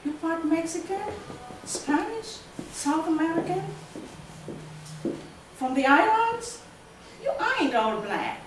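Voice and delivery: voicing a curious stranger's questions; nosy questioner in a poem. Questioners quoted within the recited poem.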